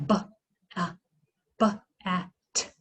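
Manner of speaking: B, a, t sounded out one by one; segmenting sounds. Shown as a demonstration. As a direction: break bat into separate sounds. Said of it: "How many sounds are there in the word bat?".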